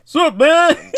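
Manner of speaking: high, loud hey there; loud greeting. Real, not acted. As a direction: call out the greeting loudly. Echoing a caller's loud greeting.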